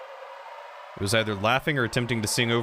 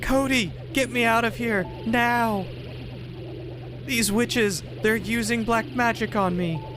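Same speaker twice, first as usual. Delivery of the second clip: high-pitched captive in indignant distress; alarmed captive; 12 semitones higher, slower than usual. Acted. Captive character cries to Cody for help against witches, high alarmed voice. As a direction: act out a high-voiced character being dragged off.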